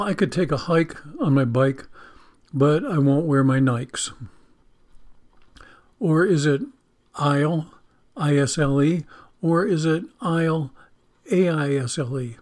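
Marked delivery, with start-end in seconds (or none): none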